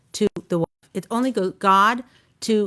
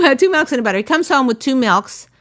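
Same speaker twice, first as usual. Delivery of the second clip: ordinary talk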